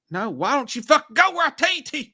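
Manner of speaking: high, loud frustrated yelling at the game; angry yell. Real, not acted. Streamer yelling at the game that won't obey, angry. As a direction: snap at the game as it ignores you.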